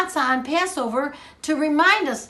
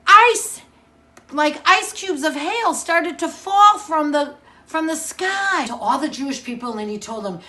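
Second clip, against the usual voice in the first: usual speaking voice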